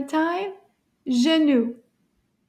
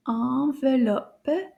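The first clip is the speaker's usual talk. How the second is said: "Enveloppe" stretched out slowly, each syllable held; slow take; slower than usual. Shown as a demonstration. Teacher saying the word together with class, slow and stretched. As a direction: say "Enveloppe" slowly so all can join. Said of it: "Now a little bit faster".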